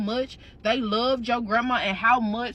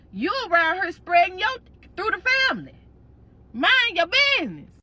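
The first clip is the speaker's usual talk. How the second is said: scolding auntie; 7 semitones higher than usual. Retold or imitated. Granny's sister telling the family off, high scolding. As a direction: put the family in their place.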